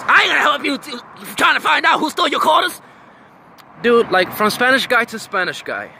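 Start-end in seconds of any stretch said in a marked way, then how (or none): 0.1-2.8 high-pitched mock protest; higher put-on retort; imitated refusal. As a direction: mimic a man refusing, pitched way up. Mimics the dude refusing.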